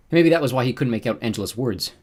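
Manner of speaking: plain reading voice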